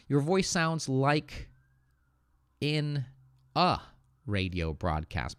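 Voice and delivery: slow, with pauses round "in" and a separate "a" before "radio"; article A set apart. Shown as a demonstration. Teacher correcting a missing article, "a" standing alone between pauses. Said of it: "because we can count".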